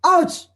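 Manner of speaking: sharp, very high, loud ouch; pained ouch. Shown as a demonstration. Teacher acting out being slapped. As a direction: cry out as if slapped. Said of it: "Somebody slaps you right now".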